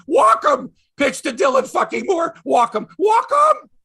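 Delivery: loud, high, exasperated shouts; re-enacted armchair fan's furious yell. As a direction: holler at a manager who can't hear. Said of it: "I'm yelling at my T V".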